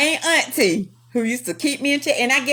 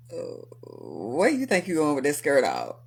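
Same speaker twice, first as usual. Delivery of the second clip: lower, stern boss asking about the skirt; low scolding boss; 8 semitones lower, 5 dB quieter than usual. Retold or imitated. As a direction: scold her like a firm older manager.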